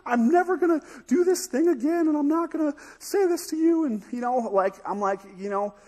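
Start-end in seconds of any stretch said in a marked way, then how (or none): 0.0-3.8 high, loud, gushing mock apology; hammed-up remorse, pitched way up; overwrought apology. Kid vowing to mom never to do this again, overwrought apology.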